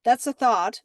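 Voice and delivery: normal talking voice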